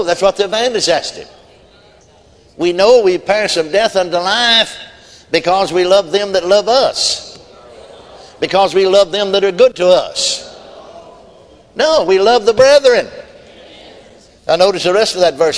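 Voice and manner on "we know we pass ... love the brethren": raised, slow, emphatic preaching. Acted. Preacher building to his congregation, slow and forceful.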